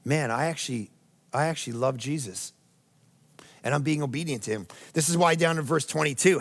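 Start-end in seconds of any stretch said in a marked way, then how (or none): 0.1-4.7 softer, heartfelt inner voice; quiet sincere. Retold or imitated. Pastor voicing a believer quietly admitting love for Jesus. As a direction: say it gently, like a private confession. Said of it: "When you're actually saying to yourself".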